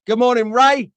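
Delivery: loud, high greeting call. Real, not acted. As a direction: call out cheerfully to nephew. Calling out greeting to nephew.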